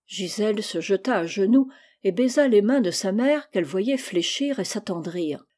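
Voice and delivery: ordinary delivery, nothing marked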